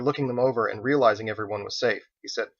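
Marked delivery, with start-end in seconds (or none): none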